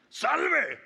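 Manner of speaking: saludo burlón muy alto y fuerte; grito burlón. Acted. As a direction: vocifera un falso homenaje. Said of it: "burlándose le gritaban". (soldado burlándose a gritos)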